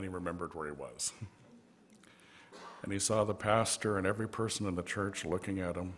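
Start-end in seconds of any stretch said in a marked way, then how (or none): none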